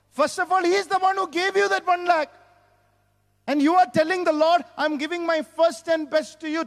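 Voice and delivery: high, insistent preaching delivery. Real, not acted. Preacher telling church who gave the one lakh, forceful preaching.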